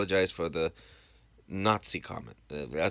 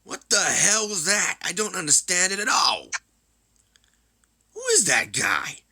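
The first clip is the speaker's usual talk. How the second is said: high, loud exasperated outburst; indignant, high-pitched yelling; outraged bafflement; 13 semitones higher, 6 dB louder than usual. Acted. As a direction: demand answers, voice leaping up. Acted outraged reaction to announcement.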